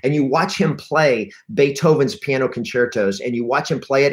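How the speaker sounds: normal talking voice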